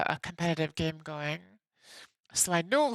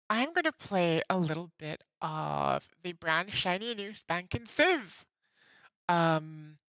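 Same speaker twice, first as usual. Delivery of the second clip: ordinary delivery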